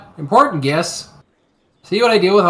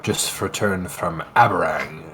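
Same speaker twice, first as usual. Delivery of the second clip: gruff Viking voice; 11 semitones lower, 7 dB quieter than usual. Acted. Player resumes Eric voice.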